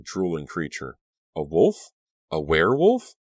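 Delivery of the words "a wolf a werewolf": loud questions rising in dread; horrified disbelief; choked with terror. A narrator's reading. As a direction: ask it aghast, building in alarm. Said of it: "Choked with terror".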